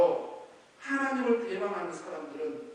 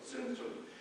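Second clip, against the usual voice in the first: usual speaking voice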